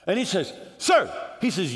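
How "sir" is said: loud, raised-pitch complaint; acted outrage; indignant husband. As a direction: demand the manager's attention.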